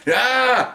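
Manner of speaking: high, sharp imitated yelp; pained cry. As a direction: mimic the guy's cry of pain. Suspect hit with baton.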